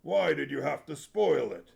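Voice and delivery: a big man sulking over a lost fight; complaining big warrior. Acted. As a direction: moan like a disappointed brawler. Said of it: "Barak complained".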